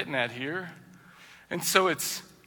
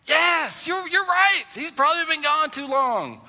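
emphatic agreement; 12 semitones higher, slower than usual. Acted. Preacher mock-affirms the angry wife.

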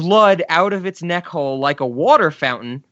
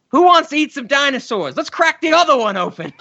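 high, eager imitation, laughing; hammed-up host; 10 semitones higher than usual. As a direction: pitch it up like a giddy party host. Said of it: "and he goes".

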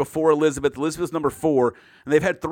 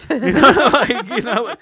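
high, loud giggly laughter through the words; laughing; 7 semitones higher, 8 dB louder, slower than usual. Real, not acted. Laughing at her own joke about going away for exhaustion. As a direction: crack up mid-sentence.

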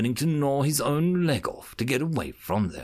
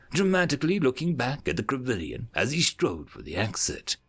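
ordinary delivery

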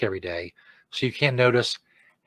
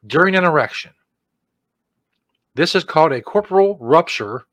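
raised, emphatic mock announcer reading; optimum-level announcer read; 3 semitones higher than usual. Host reading drug side effects aloud, mock-serious announcer. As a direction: punch the medical terms like a grand warning. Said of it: "my voice to optimum level".

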